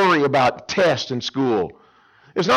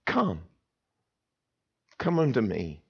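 tender invitation; 4 semitones lower, 7 dB quieter than usual. Acted. God the father inviting tenderly.

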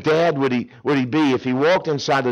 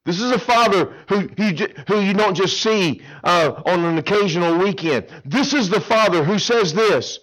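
ordinary talk

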